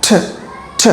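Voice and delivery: ঠ said as a breathy aspirated th; aspirated t sound. Shown as a demonstration. Teacher drilling the ঠ sound for students. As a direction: puff air out on ঠ. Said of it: "এটার ফনিক sound হচ্ছে ঠ".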